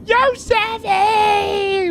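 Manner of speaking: very high, long drawn-out holler; hyped shout. Pretend. Streamer welcoming another viewer, hyped shout. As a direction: stretch the greeting out high and excited.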